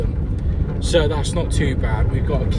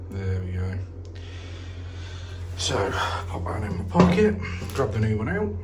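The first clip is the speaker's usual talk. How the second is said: whispering; 7 semitones lower, 7 dB quieter than usual. Real, not acted. Quiet aside while working.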